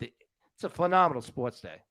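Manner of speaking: mixed Philly, Boston and New York accent; Northeast accent. Shown as a demonstration. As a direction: say it like a guy from the Northeast. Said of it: "Let me say it like all my friends up in the north".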